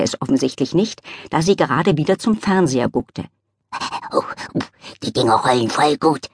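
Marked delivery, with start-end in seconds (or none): 4.1-6.3 low, happy dog voice; delighted dog voice rolling a screw; woofing dog voice. Acted. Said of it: "wuffte der Hund".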